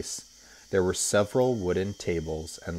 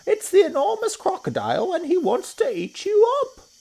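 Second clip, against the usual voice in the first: very high, loud monkey shrieks; high-pitched screeching alarm; urgent shout; 9 dB louder than usual. A narrator's reading. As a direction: yell a frantic warning to children. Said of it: "Mugglewump shouted to the children".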